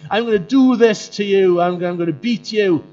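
hammed-up bragging warrior threat; boastful Goliath. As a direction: puff up and taunt like a bully giant. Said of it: "Goliath, who's like".

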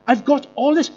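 mocking show-off rise; smug boasting. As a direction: show off what you have, pitch up. Voicing proud modern culture.

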